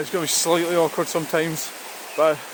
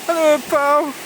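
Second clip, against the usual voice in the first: high, slow sing-song pet talk; cooing high at a wild bird on the river; 9 semitones higher than usual. Real, not acted. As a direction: coo at the heron like a pet. Greeting a heron on the riverbank.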